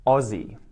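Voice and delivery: "Aussie" said as OZ-ee, Z in the middle; Aussie with a buzzing z, stress first; "Aussie" slow and clear, ah-zee; saying Aussie. Shown as a demonstration. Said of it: "a beautiful example of that is".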